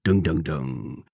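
low, slow ominous "dung dung dung"; mock ominous music cue; dramatic sting. Dramatic sting after topic.

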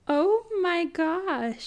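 slow, high, drawn-out oh my gosh; shocked gasp. Real, not acted. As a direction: let the shock stretch every word. Reacting to makeup man quote.

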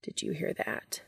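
soft whisper in the ear. Acted. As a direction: breathe it into his ear. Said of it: "whispers into his ear".

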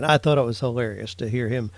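ordinary talk, nothing marked

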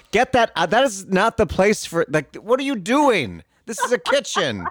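high, scandalized scolding; indignant scolding. Retold or imitated. Woman in the kitchen scolding a breastfeeding mom, high and indignant. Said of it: "she's like".

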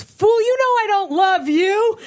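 high, slow, hammed-up girlfriend voice; theatrical high-pitched rebuff; scornful rejection. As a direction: put on a high, drawn-out scornful refusal. Girlfriend rejects the proposal, acted.

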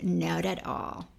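slow, deadpan no; flat dismissal. Retold or imitated. Manager judging the guy's looks, deadpan slow. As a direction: drag it out unimpressed. Manager says he wasn't cute.

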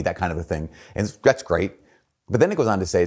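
ordinary talk, nothing marked

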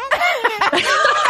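a friend's mock celebration; mock cheering. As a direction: chant it like a World Cup win. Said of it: "ficou numa animação".

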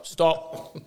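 officious steward halt. Retold or imitated.

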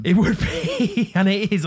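slow, laughing agreement; drawn out and giggling as you concede. Real, not acted. Agrees name is terrible.

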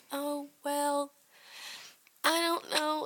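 high, unsure, dithering friend; hesitant friend voice. Retold or imitated. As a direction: hem and haw like a reluctant friend.

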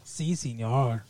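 soft, slow token Mexican accent; mock Mexican accent. Shown as a demonstration. Showing off to co-host, low-key fake Mexican accent. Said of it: "I can do a Mexican accent".

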